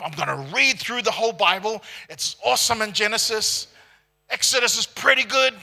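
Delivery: eager Bible reader. Retold or imitated. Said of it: "those who love to start the year off".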